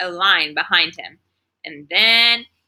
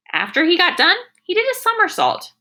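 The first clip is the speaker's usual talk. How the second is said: high, wide-eyed telling; amazed; 10 semitones higher than usual. A narrator's reading. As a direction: tell it with giddy amazement. Amazed narration.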